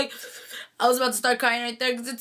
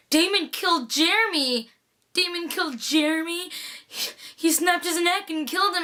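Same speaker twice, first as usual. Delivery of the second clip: higher-pitched, mildly upset imitation; retold distress; 5 semitones higher than usual. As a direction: act out a shocked realization, lightly. Voices character's distressed realization.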